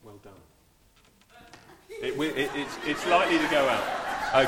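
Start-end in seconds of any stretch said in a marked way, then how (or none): 1.9-4.5 laughing. Real, not acted. Candle lighting with a child.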